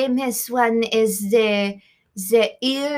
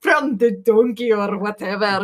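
teasing Scottish echo; mock Scottish accent; 3 semitones lower, 4 dB louder than usual. Guest repeating the interviewer's answer, mocking Scottish accent. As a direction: parrot it back in an imitated Scottish accent. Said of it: "Don't make fun of me".

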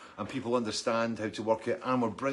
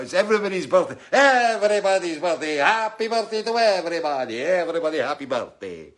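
gleeful birthday cheer; 7 semitones higher, 9 dB louder than usual. Real, not acted. Cheerful birthday greetings to everyone.

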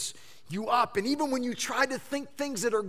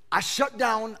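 normal talking voice

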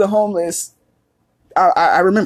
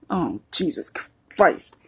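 ordinary talk

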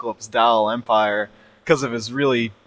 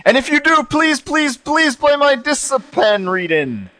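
mock pleading; 13 semitones higher, 3 dB louder than usual. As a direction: beg like a fan pleading to be read. Reading out a listener's pleading email.

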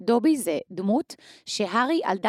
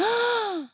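gasp; 13 semitones higher than usual. Real, not acted. Realizes something mid-sentence.

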